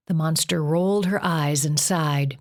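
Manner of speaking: ordinary delivery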